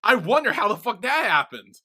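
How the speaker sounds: loud, high sarcastic exclamation; pointed accusation. Retold or imitated. Teasing co-host about what they skipped, loudly sarcastic.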